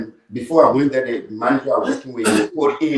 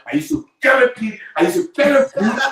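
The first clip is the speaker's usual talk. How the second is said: very high, amused exclaiming; exuberant testimony; 11 semitones higher than usual. Real, not acted. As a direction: marvel at your own past in a high, laughing voice. Exuberant testimony of humble beginnings.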